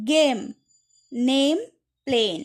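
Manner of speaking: গেম, নেম, প্লেন with "ay" like the letter's name; long A sound. Shown as a demonstration. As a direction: hold the vowel in গেম, নেম, প্লেন, word by word. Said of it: "এটা এর মতো উচ্চারণ হচ্ছে". (গেম, নেম, প্লেন এ টেনে, নামের মতো)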